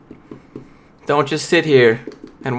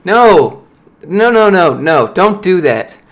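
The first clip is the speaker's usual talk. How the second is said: loud scoffing no; 5 semitones higher, 5 dB louder, slower than usual. Real, not acted. Rejecting lazy Sunday posts.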